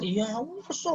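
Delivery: put-on small high-pitched child voice, softly; upset child's reply. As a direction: lightly imitate a sulky kid admitting it. Child answers the parent, upset. (menirukan anak kesal, suara kecil)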